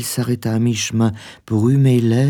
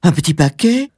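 high, louder, rising cry; wife crying out in disbelief; exclaimed question; 8 semitones higher, 4 dB louder than usual. A narrator's reading. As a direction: echo it as a startled high-voiced exclamation. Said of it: "s'écria madame Foster".

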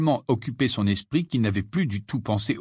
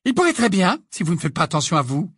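higher, louder, quick reproach; girl angrily warning the twins, higher and fast; angry; 8 semitones higher, 4 dB louder, faster than usual. A narrator's reading. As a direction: snap at the twins in anger. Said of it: "intervint Hermione avec colère".

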